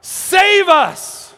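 high, loud desperate cries; acted panicked shouting; screaming for help. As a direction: cry out in terror to be saved. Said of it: "And they start screaming".

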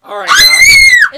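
very high, loud, slow announcer yell; announcer shout. Acted. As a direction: belt it like a show host. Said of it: "our famous movie review".

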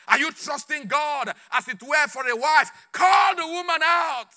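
loud, rising to a high, drawn-out shout; impassioned exhortation. Real, not acted. Preacher urging those seeking a wife, impassioned. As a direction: build to a shouted command.

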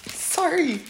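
high, choked-up apology; emotional. Real, not acted. Teacher apologizing mid-scripture reading, emotional. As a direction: apologize while fighting back real tears. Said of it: "it makes me emotional".

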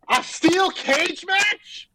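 slow, high-pitched disbelief; incredulous outrage. Real, not acted. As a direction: draw each word out, rising in appalled disbelief. Ranting about match choice.

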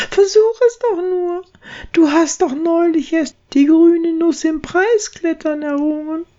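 high, urging little mouse; squeaky mouse voice. Acted. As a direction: coax him like a tiny mouse. Braut ermutigt. (hohes, ermutigendes Piepsen)

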